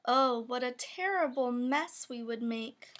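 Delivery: plain reading voice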